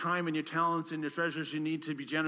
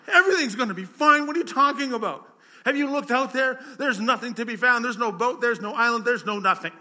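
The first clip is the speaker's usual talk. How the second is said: high, loud, disbelieving outburst; can't believe what he's hearing; incredulous panic; 5 semitones higher, 9 dB louder than usual. Acted. Panicked castaway scoffing at his calm friend, loud and high.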